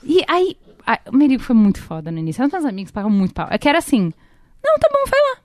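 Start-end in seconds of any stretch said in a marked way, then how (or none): 4.6-5.4 put-on easygoing boyfriend.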